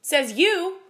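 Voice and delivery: loud, high, scornful comeback; cutting and indignant; angry retort. A narrator's reading. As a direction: throw it back at him angrily. Angry wife retorts to husband.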